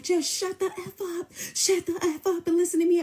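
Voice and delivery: hammed-up high-pitched tirade; mock yelling. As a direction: imitate him losing it on the phone. Mimicking him yelling at her.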